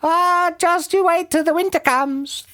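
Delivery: high-pitched bird character; roly-poly bird. A narrator's reading. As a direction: warn in a very high creature voice. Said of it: "the roly-poly bird said".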